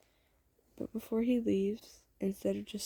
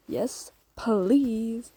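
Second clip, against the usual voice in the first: eager acceptance; 3 semitones higher, 7 dB louder than usual. Acted. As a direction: burst out with an emphatic acceptance. Acted eager yes to proposal.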